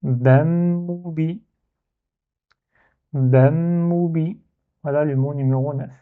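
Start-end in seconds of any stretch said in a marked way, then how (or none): 0.0-1.4 "bam, moubi" run together with no break; "bam, moubi" as one word, doubled m held; joined in one go. Shown as a demonstration. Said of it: "prononcer en un seul syllabe". 3.1-4.4 "bam, moubi" run together with no break; "bam, moubi" as one word, doubled m held; joined in one go. Shown as a demonstration. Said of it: "prononcer en un seul syllabe".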